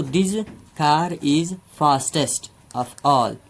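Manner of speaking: very slow, word by word example sentence; slow dictation. Shown as a demonstration. Teacher dictating an example to students, very slow. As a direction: spell it out slowly for the class.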